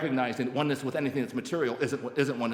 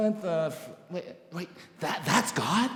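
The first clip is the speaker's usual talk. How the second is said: halting, higher-pitched rising question; stunned disbelief; 4 semitones higher than usual. Retold or imitated. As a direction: stammer it back as an astonished question. Stunned question back to sponsor.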